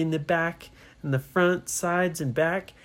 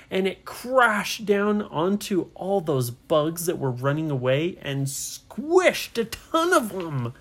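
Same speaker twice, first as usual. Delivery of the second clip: plain reading voice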